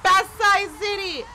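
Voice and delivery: loud, high triumphant shout; screaming joy. Real, not acted. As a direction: scream the city name in victory. Winner announced, celebrating.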